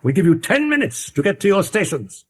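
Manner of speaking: Utterson's orders. Acted. Utterson giving orders in scene.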